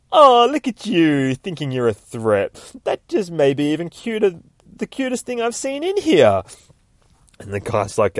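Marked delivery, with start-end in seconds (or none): 0.1-6.4 mocking cutesy voice. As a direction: coo at him like he's a puppy. Deadpool mocking the armed doctor.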